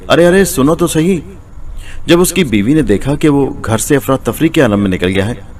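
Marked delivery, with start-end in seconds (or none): 0.1-1.2 higher, calling out after him; wife calls to her husband leaving. A narrator's reading. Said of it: "उसकी बीवी उसे पुकारती ही रह गई".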